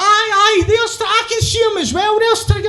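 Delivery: high, eager pretend sighting; playing along excitedly. Telling Stracky he sees Trigger too, feigned excitement.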